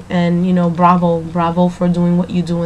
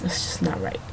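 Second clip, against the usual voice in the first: breathy whispered aside; 12 semitones lower, 12 dB quieter than usual. Real, not acted. Missing her four o'clock show, she whispers to herself. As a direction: drop to a whisper for the regret.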